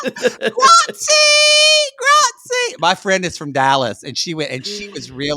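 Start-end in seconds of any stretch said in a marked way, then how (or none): 0.1-2.8 very high, loud laughing grazie; mock Italian thanks. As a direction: shout grazie like a giddy tourist. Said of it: "And they'd be like".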